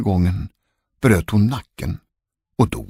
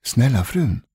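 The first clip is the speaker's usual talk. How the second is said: lightly voiced, higher-pitched maid; chambermaid; 5 semitones higher than usual. A narrator's reading. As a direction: plead politely as a lady's maid. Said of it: "sa damens kammarjungfru". (kammarjungfru, lite ljusare röst)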